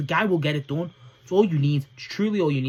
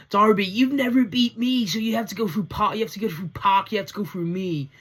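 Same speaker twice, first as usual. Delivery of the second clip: mock Yuta's whine, pitched up and nasal-free but petulant; whiny voice; 6 semitones higher than usual. Yuta taunting Darby, imitated in a high whiny voice. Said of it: "with his whiny ass voice".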